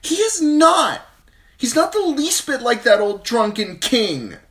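high, loud tearful scream. A narrator's reading. Sansa screaming at her sister in grief. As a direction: shriek at your sister in grief. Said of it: "she screamed at her sister".

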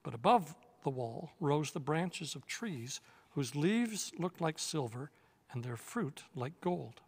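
usual speaking voice

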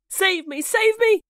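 very high, loud plea for rescue; panicked cry. A narrator's reading. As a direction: shriek for help like a hunted boy. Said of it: "cried Nibs".

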